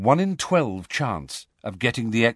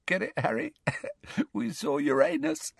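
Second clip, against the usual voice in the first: soft, very high, giddy titter; feeble giggling; 6 dB quieter than usual. A narrator's reading. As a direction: squeeze the joke out through giggles. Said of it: "still giggling feebly".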